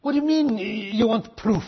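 normal talking voice

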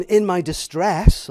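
scripture read with "distress" hammered; stressed distress. Shown as a demonstration. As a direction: lean hard on "distress", slow and loud. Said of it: "I emphasize the distress".

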